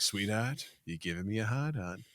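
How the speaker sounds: a hint of a low, soft gangster growl; lightly voiced menacing mobster; imitating Nicholson. Host retells the scene to his co-host in a lightly imitated gangster voice.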